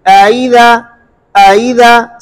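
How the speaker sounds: अइज़ा with the second hamza eased toward ya; tas'heel demo. Shown as a demonstration. Teacher models अइज़ा for students, careful recitation. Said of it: "अइज़ा में दूसरे हमजे में तस्हील करेंगे".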